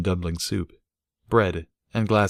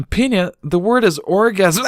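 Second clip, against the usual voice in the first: higher, louder helpful colt; 13 semitones higher, 6 dB louder than usual. A narrator's reading. Boyfriend helpfully correcting the little filly. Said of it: "tried to say helpfully".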